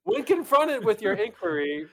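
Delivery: reading while laughing; giggling through the words. Acted.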